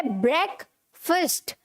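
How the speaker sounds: ब्रेकफास्ट slowly as "brek... fust"; correct pronunciation. Shown as a demonstration. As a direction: split ब्रेकफास्ट into two short-vowel syllables. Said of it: "क्या होता है?".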